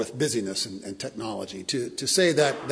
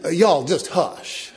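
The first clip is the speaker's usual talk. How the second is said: louder, slow Southern Georgia hush; Georgia drawl; 3 semitones higher, 7 dB louder, slower than usual. Retold or imitated. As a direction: hush them folksy, Georgia style. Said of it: "That's the Georgia translation".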